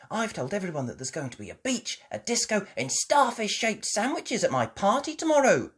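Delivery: put-on quick, bright excited child. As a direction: gush it out eagerly. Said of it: "Peppa was very excited".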